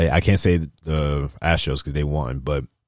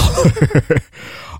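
laugh; 11 semitones higher, 4 dB louder than usual. Real, not acted. Laughs mid sports recap.

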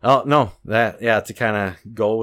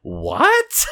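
high rising incredulous what; surprised what. Retold or imitated. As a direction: ask it in pure disbelief. Stunned retort in a story.

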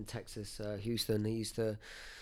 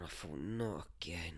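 quietly heartbroken; 5 semitones lower, 9 dB quieter than usual. Retold or imitated. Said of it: "I just felt heartbroken".

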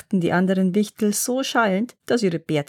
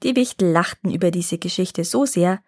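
plain reading voice

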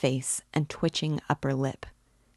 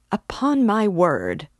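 loud, higher, drawn-out indignant cry; wrathful, irritable; 5 semitones higher, 6 dB louder, slower than usual. A narrator's reading. Luzhin answering an insult, crying out wrathfully. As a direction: cry out in slow, irritated outrage. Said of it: "wrathfully and irritably".

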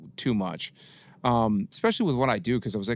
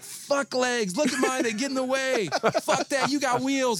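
hammered pep talk; 12 semitones higher than usual. Retold or imitated. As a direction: cheer him up like a wasted buddy. Drunk self consoling man.

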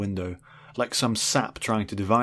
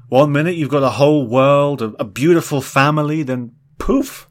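put-on loud drunken lament; 5 semitones higher, 9 dB louder than usual.

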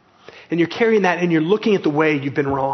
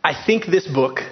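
ordinary talk

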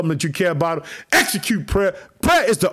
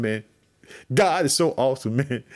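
ordinary talk

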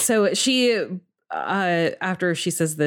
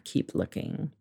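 whispered voice line; 3 semitones lower, 10 dB quieter than usual. Retold or imitated. Voicing the mysterious voice.

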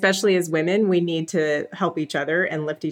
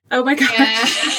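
high, loud, drawn-out laughing gasp of disbelief; amused astonishment; 7 semitones higher, 6 dB louder, much slower than usual. Real, not acted. Host hears of the Star Wars job, amused astonishment. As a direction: react big and slow, laughing.

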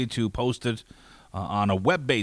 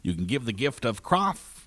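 ad read; 5 semitones higher, slower than usual. Acted. Sponsor read for the coffee shop.

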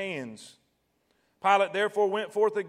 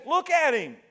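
raised, louder plea to the crowd; pleading Pilate; 6 semitones higher, 5 dB louder than usual. Retold or imitated. Pilate urging the crowd to pity, loud plea. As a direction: show him off, begging them to relent. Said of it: "it'd be like this".

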